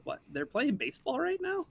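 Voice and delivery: high, startled disbelief; shocked it's on now; surprised. Retold or imitated. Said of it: "I was like".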